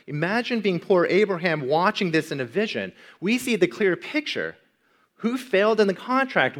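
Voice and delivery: loud, high, fervent preaching; preaching at full force. Acted. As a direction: lift the whole room, full voice. Preacher's loud climactic appeal.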